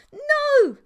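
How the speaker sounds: high, loud monster scream of "No!"; a piercing high shriek; yell. A narrator's reading. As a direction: shriek it at him, furious. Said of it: "she yelled".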